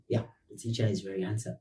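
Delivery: quick joking aside. Real, not acted. Teacher slyly agreeing he is handsome, quick soft aside. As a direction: mutter it as a cheeky joke about yourself. Jokey self-praising aside after translation.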